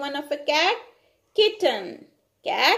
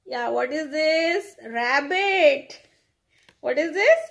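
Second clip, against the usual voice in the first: normal talking voice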